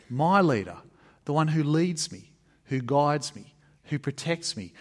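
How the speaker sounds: ordinary delivery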